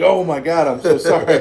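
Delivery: loud, high, suddenly wide-awake apology; played-up shock and embarrassment; mortified apology. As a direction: blurt an apology, half laughing. I woke up immediately.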